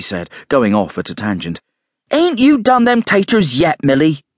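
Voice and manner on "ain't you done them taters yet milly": high, loud nagging call; scolding call. A narrator's reading. Calling out to the maid.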